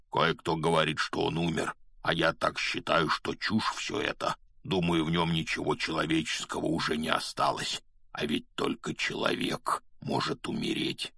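low voice of a big man, remembered; a low, partly breath-only voice echoing from memory; Hagrid. A narrator's reading. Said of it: "сказанные ему Хагридом в ту ночь".

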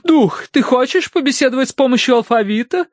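high, thrilled hostess voice; восторженный вопрос. A narrator's reading. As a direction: ask the spirit with eager delight. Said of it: "восторженно уточнила миссис Меринг". (восторженно, высоким голосом)

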